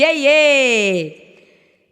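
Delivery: plain reading voice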